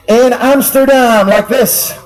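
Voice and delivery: usual speaking voice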